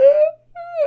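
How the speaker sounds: very high mock crying wail; imitated frightened wail. Imitating the girl wailing at the thunder, high and crying. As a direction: wail like a scared kid.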